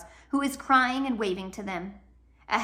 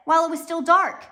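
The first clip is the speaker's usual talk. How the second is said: urgent; 7 semitones higher, 8 dB louder than usual. A narrator's reading. Klaus urgently calls out.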